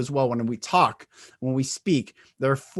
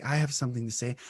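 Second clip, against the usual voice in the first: soft, small voice; hushed, hesitant delivery; quiet; 4 semitones lower, 8 dB quieter, much faster than usual. Shown as a demonstration. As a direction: say it timidly, barely above a murmur. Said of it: "Do you speak like this?".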